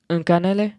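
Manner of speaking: "Un cannelé" said uhn kah-neh-LAY, rising; trying "Un cannelé" like a French order; French phrase. Shown as a demonstration. Host ordering the pastry in mock French, rising. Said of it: "I think I got it".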